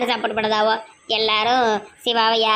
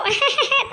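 short high giggle; gleeful laugh; 11 semitones higher than usual. Acted. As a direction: burst out laughing with glee. Gleeful over rival's beating. (மகிழ்ச்சியான சிரிப்பு)

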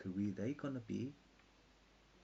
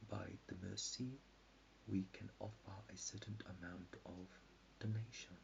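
hushed, halting recitation; 9 dB quieter than usual. Real, not acted. Speaker rambling about trust and water chemistry, hushed and halting. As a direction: let each phrase drop softly and hang.

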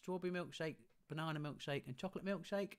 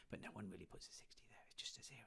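whispering; 5 semitones lower, 14 dB quieter, faster than usual. Real, not acted. Aside whisper to class.

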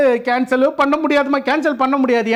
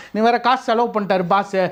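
normal talking voice

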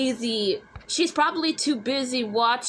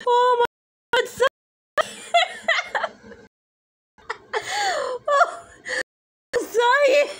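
high, squealing through laughter; cracking up; 9 semitones higher than usual. Acted. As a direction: barely get it out between laughs. Cracking up at a picture.

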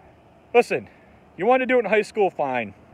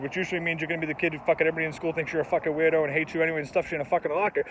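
ordinary talk, nothing marked

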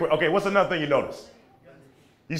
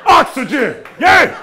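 high, very loud cheering; hammed-up ecstatic shouting, pitched way up; mock wild excitement; 9 semitones higher, 9 dB louder than usual. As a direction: whoop it like an overjoyed fan. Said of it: "if I was like".

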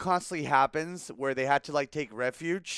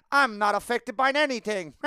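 mock bravado; 5 semitones higher, 3 dB louder than usual. Mock tough claim, laughing.

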